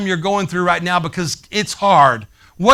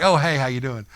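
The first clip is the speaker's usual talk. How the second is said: mock casual greeting; 8 semitones lower, 6 dB quieter than usual.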